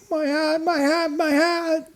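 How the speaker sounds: put-on high, loud wailing child; distraught little girl crying out. A narrator's reading. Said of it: "she cried".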